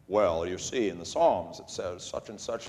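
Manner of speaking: low-pitched mock theologian voice; pious theologian. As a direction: drop low and imitate a pious scholar lecturing. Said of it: "versus the pious theologians".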